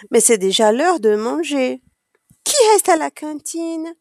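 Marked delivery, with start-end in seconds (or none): 2.4-4.0 raised pitch, calling out to a group; calling question. A narrator's reading. Teacher asking the children about lunch, calling out. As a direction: put it to the whole class in a lifted voice. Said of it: "demande Stéphane".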